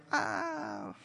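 low, soft, slow, half-whispered price; sly seller. Acted. Ephron slyly naming his price to Abraham, low and soft. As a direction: slip the price in sweetly. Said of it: "Ephron is like".

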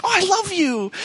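high-pitched gushing dog voice; overjoyed dog. Acted. Dog greeting someone at the door, high-pitched and adoring. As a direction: squeal it out like a lovestruck dog. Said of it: "the dog is like".

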